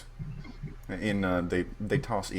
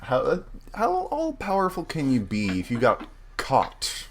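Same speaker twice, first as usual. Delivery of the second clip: scoffing; 6 semitones higher than usual. Acted. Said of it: "says Bapito".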